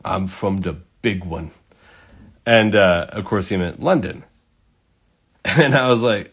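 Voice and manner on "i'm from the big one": hammed-up English accent, mate; badly faked English accent; terrible English accent. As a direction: do a bad English bloke impression. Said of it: "do my terrible English accent for this".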